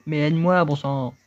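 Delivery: high, loud bossy character voice; a cranky little creature ordering the kid to help; impatient talking hat. Acted. Talking hat scolding the boy to help him, high and loud.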